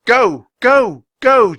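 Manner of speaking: high, loud repeated shouts; shouting. A narrator's reading. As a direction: bark each word to drive it back. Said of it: "shouted Jack".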